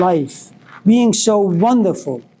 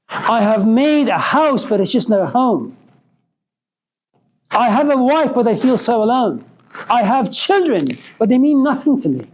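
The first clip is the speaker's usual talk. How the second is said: higher, pleading lament of a lost soul; despairing salmon; 5 semitones higher than usual. Acted. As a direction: voice the salmon's empty heartache, raised and urgent. Salmon laments his empty life.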